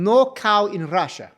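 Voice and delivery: slow, hammed-up fake Russian accent; a joking, deliberately bad Russian accent. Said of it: "that is not a Russian accent".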